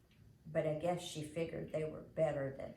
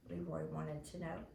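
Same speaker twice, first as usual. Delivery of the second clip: ordinary delivery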